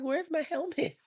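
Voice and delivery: put-on earnest little-girl question, pitched high; high child voice. Daughter asking mom for her helmet in a high voice. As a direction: ask it like a serious small kid.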